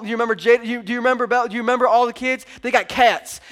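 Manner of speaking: ordinary talk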